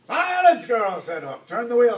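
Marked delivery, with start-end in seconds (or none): 0.1-1.0 very high, loud bark for silence; commanding shout. A narrator's reading. Captain silences the girl. 1.5-2.0 high, loud shouted order; impatient command. A narrator's reading. Captain orders his mate, impatient.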